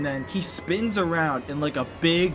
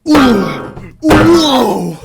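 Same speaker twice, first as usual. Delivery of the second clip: excited ooh; 9 semitones higher, 16 dB louder than usual. Retold or imitated. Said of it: "they're like".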